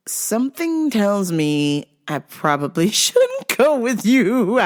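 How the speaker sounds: drawn-out, hedging through laughter; amused, slow sing-out refusal; laughing reluctance. Retold or imitated. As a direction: slowly beg off, breaking into a laugh. Retold reluctant reply, laughing.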